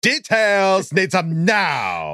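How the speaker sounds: put-on high, slow valley girl drawl; ditzy Valley Girl voice. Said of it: "The Valley Girl".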